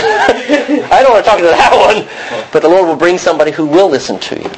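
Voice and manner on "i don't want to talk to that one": high, slow, laughing reluctance; playfully imitating a reluctant believer, laughing; laughing mock refusal. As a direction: laugh through a pretend refusal.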